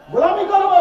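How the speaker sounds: high vow. Real, not acted. Repeated vow.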